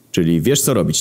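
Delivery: ordinary delivery, nothing marked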